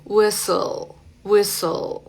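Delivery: "whistle" said as "wissel"; silent T. Shown as a demonstration. Teacher giving another example, silent T. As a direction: leave the T out of "whistle". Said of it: "silencio total".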